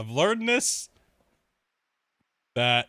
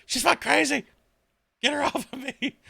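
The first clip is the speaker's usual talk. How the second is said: much higher and louder, frantic squeal; panicked dog voice; 12 semitones higher, 4 dB louder than usual. Retold or imitated. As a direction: play the wiener dog begging to get the cat off. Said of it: "He's like".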